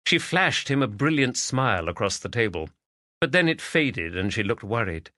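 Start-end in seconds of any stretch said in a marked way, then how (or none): none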